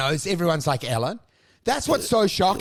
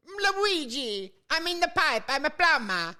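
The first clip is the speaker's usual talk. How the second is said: high cartoon Italian accent; 7 semitones higher than usual. Mocking the ice cream seller Italian, high cartoon accent. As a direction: ham up the Italian plumber. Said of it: "The ice cream seller".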